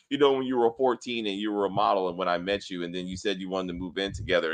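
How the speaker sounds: low, soft character recapping the obvious; mock expository dialogue. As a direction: parody clunky explain-it-to-the-audience dialogue. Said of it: "you're really trying to explain it to us".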